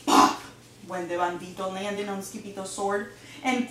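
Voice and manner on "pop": sudden explosive pop; loud popped "pop". A narrator's reading. Narrator mimics the bandit bursting on the sword, a loud pop. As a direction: burst the word out in one hit.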